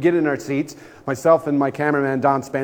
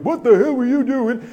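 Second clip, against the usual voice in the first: high, loud accusing shout; the big dude confronting him again; angry high yell; 10 semitones higher, 4 dB louder than usual. Retold or imitated. Big man yelling at the guest in the hallway, high shout. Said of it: "and again with".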